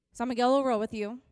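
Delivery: normal talking voice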